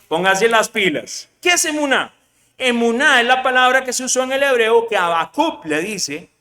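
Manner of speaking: normal talking voice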